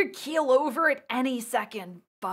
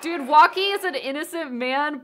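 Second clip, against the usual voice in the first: upset; 4 semitones higher, 6 dB louder than usual. Real, not acted. Upset at innocent client dying.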